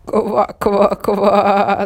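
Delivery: loud croaking laugh. A narrator's reading. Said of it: "засмеялась лягушка".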